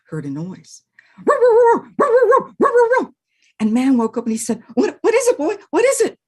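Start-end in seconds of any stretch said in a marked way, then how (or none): 1.2-3.1 high, loud yappy woo barks; excited high dog bark. Acted. Dog barking in story. 4.7-6.2 high, louder, urgent asking; curious excited man; alert man. Acted. Man wakes to barking.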